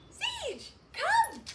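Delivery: high, loud call to the puppy; high loud excited dog recall. Shown as a demonstration. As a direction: call her in with a big happy voice. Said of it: "so you can see that with her".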